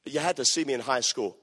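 ordinary delivery

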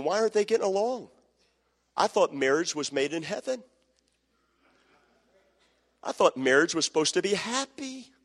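mock child asking why; puzzled child. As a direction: play the confused kid watching the parents fight. Kids puzzled by fighting parents.

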